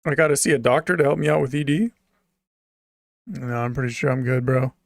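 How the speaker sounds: normal talking voice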